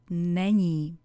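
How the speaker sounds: usual speaking voice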